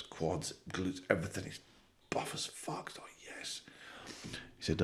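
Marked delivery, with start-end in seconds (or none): 1.4-3.6 breathy whisper; whispered, half to himself; whispered aside. Acted. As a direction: drop to a whisper for the punchline aside. Aside in joke narration.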